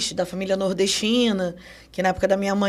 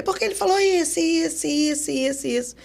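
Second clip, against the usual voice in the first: imitating daughter; 6 semitones higher than usual. Mimicking the daughter to therapist.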